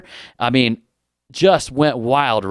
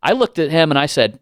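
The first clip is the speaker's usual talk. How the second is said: ordinary talk, nothing marked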